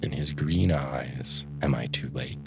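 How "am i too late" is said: low, hushed, hoarse question; understated sob, low and soft; hoarse, sobbing. A narrator's reading. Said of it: "he demanded hoarsely, sobbing".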